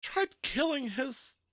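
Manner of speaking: high, loud, drawn-out words; angry yell. Acted. Player bashing Magdor with a cup, slow angry yell. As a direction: yell each word between blows.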